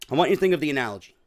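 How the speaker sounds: "think of the analogy" dropped low and rattled off; low quick repeat. Real, not acted. Comic repeating the setup to listeners, low and quick. Same words repeated lower, faster.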